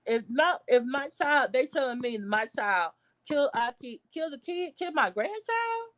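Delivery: normal talking voice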